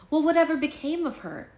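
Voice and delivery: put-on high, eager kid asking; curious child voice. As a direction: ask it like a wide-eyed child. Character question in story.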